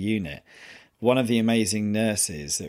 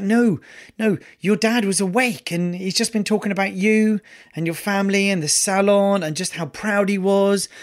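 high, louder imitation of nurse reassuring; 9 semitones higher, 3 dB louder than usual. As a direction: voice the nurse rushing to comfort.

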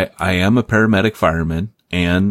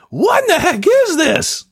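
much higher, mock-bewildered listener; baffled listener. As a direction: play a confused podcast listener. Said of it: "picturing people listening to this podcast going".

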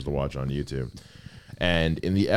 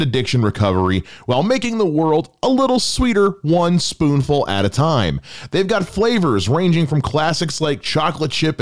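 upbeat, higher-pitched sponsor read; lively podcast commercial delivery; 8 semitones higher, 5 dB louder than usual. Acted. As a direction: sell it with bouncy, punched-up emphasis. Reads a sponsor ad.

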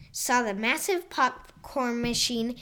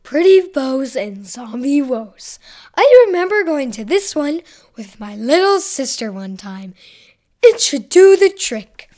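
Donut character voice; 7 dB louder than usual. Acted. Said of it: "said Donut".

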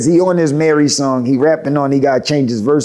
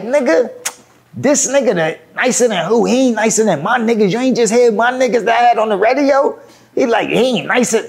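dismissive trash talk; 7 semitones higher than usual. Retold or imitated. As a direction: retell it as an incredulous, scornful put-down. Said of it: "He start talking like that".

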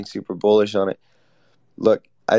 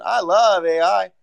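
higher, louder, slow, sarcastically gushing; overdone inflection; 10 semitones higher, 6 dB louder, slower than usual. Acted. To co-host who called him insincere, sarcastic gushing. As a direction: lay the enthusiasm on thick to mock the co-host. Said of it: "I'll put more inflection in my voice".

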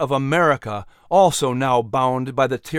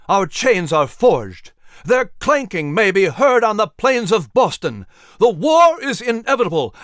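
louder and higher, building to a roar; full-volume oration; 5 semitones higher, 4 dB louder than usual. Acted. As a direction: thunder it at the convention. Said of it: "allowing the volume of his voice to reach its maximum".

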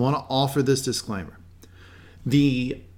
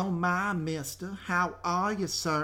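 mock Southern belle accent, higher and softer; Miss Riker's Southern accent; 5 semitones higher, 6 dB quieter, slower than usual. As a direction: put on a bad, overdone Southern drawl. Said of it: "It is the accent of Miss Riker".

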